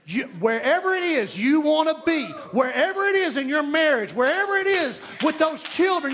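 rising pulpit exhortation; preaching build. Acted. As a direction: rise up and reach the congregation.

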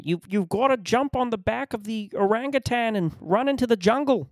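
very high, strained caricature voice; Jordan Peterson. Acted. Comic mocking the guru's weird allegories, high-pitched caricature. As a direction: push it way up into a mocking falsetto caricature. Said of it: "I almost feel like Jordan Peterson has this".

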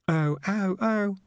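high, drawn-out echoed cry; echoing call. A narrator's reading. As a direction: call like an echo through pipes. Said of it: "echoed through the pipes".

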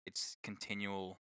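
ordinary delivery

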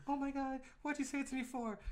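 high-pitched imitation of an alarmed passenger; mock freaked-out woman. Upset passenger protesting to the stranger, mock alarm. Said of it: "all she could do was like".

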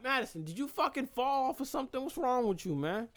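high-pitched, incredulous telling-off; exasperated scolding. Real, not acted. Host calls out Madison over a fall, exasperated.

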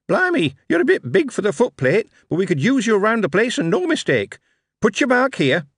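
put-on high chirpy young clerk; bright, quick recruiting officer; high eager Billy voice. Billy speaks.

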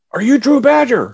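high, loud eager question; excited stranger. Retold or imitated. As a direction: call out in thrilled recognition. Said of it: "somebody goes".

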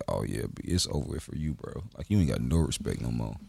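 a low, soft lament over his fate; low mock pity. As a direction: deliver it low like final condolences. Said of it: "I said".

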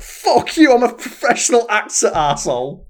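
high, explosive mock-enraged shout; acted furious outburst. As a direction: yell it as an actor finally snapping at a casting director. Imagined actor lashing out at casting director.

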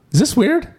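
way up high, teasing the awkward moment; playful high question. Retold or imitated. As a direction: squeak the question out in mock innocence. Pastor's question retold, very high.

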